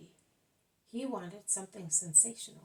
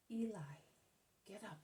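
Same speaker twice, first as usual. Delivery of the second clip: whisper; 6 dB quieter than usual. Acted. Whispered call to old man.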